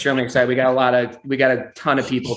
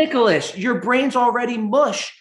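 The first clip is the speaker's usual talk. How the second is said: much higher, exasperated mother voice; a worried mom scolding her son, pitched way up; imitating scolding mom; 10 semitones higher than usual. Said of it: "She's like".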